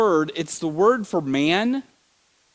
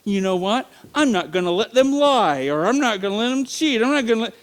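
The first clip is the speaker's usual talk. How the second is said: higher, mock-stern indignation; angry God; 6 semitones higher than usual. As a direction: act out a petty, indignant God laying down rules. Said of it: "he's this angry God that's going".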